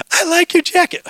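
high-pitched playful compliment; hammed-up high gush; mock admiring. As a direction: imitate an eager admirer. Mimics a gushing admirer, jokingly.

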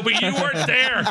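high-pitched mock outrage; retold reproach. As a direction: accuse the friend indignantly. Scolding friend for missing fight.

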